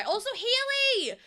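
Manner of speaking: high, bright call-out of a name; excited greeting. Real, not acted. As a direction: call out to a viewer you spotted. Greeting a chat member.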